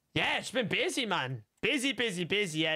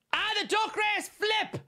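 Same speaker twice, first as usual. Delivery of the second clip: excited shout; 11 semitones higher, slower than usual. Real, not acted. Reacts to game moment.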